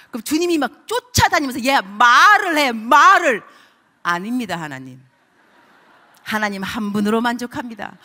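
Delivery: normal talking voice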